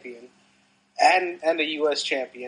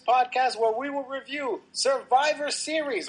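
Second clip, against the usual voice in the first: ringmaster; 5 semitones higher than usual. Acted. Podcast opener in circus-announcer style.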